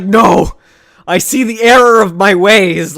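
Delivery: a loud, fake-sincere turnaround; mock villain repentance. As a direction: overplay the villain instantly giving in. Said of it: "and he's like".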